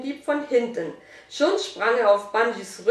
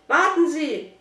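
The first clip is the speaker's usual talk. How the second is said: higher, urgent call; excited call; 6 semitones higher, 4 dB louder than usual. A narrator's reading. Boy tells the shop woman to wait, excited call. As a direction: call out, worked up. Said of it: "rief Dominik erregt".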